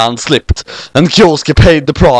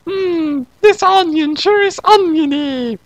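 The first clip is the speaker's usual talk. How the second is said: put-on much higher, slow musing girl; slower than usual. Kyoko chewing an onion, high musing voice. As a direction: savour it in a squeaky voice.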